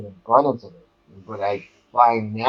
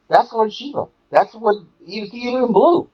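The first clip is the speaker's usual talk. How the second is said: much higher, astonished exclamation; amazed recognition; 11 semitones higher than usual. Retold or imitated. Recalling seeing the picture of Shiva, voice jumping up in amazement. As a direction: gasp it out like the dream just came true.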